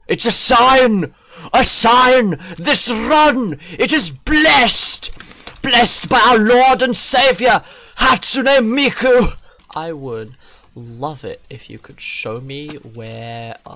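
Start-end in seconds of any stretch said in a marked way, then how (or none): 0.1-9.4 loud, high-pitched revivalist shouting; mock preacher's proclamation. Player hailing a blessed run, shouted mock sermon.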